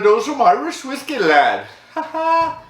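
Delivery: high, hammed-up Irish lilt; mock Irish. Host announcing some Irish whiskey, mock Irish. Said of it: "I don't know why I'm talking like that".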